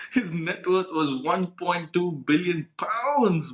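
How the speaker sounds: slow, incredulous reveal after a laugh; amazed laughing. Real, not acted. Host revealing a stranger's huge net worth, amazed and laughing. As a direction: laugh first, then draw out the figure.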